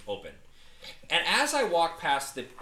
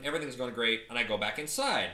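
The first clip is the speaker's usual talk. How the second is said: ordinary talk, nothing marked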